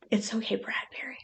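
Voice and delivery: soft whispered comfort to a pet; reassuring whisper. Real, not acted. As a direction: hush him gently, barely breathing. Soothing the scared turtle.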